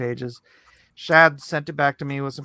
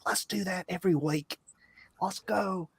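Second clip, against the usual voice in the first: put-on lightly done Australian accent; hint of an Aussie twang; 4 semitones higher, 8 dB quieter than usual. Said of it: "That's a terrible Australian accent".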